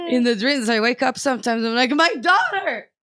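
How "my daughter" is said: high, loud wail of panic; hammed-up desperate parent; mock anguished cry. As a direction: cry out as if waking from a nightmare. Acts out waking from a nightmare.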